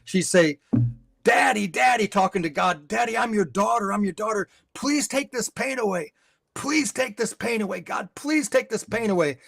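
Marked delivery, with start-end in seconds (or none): 1.2-9.4 high, pleading cry to God, lightly acted. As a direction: retell her desperate plea in a raised voice. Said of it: "she'd cry out".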